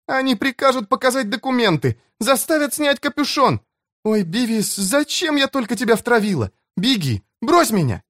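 put-on high, frightened girl's plea; panicked pleading. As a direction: beg him to run, in panic. (испуганная девушка умоляет)

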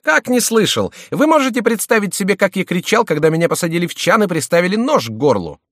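very high, loud disbelief; incredulous, high-pitched protest; indignant retort. A narrator's reading. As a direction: fire back, outraged, at top pitch.

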